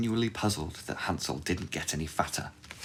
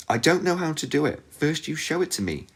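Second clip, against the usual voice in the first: put-on high, innocent girl voice; young girl voice; 10 semitones higher than usual. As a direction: play dumb like a little girl. Gretel answers the witch.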